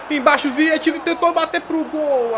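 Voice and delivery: rising excitement on the shot; excited commentary. Real, not acted.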